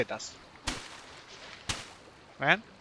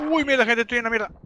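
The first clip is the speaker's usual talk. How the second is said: high panicked swearing; 8 semitones higher, 3 dB louder than usual. Real, not acted. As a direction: cry out in trouble. Losing the fight to ants. (pánico, voz muy aguda)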